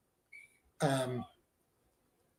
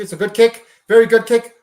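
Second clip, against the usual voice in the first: climbing higher and louder over a good kick; building excitement; 5 semitones higher, 9 dB louder than usual. Real, not acted. Fan cheering his team's long kick, building to a shout. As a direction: let the praise swell to a cheer.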